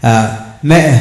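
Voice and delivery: normal talking voice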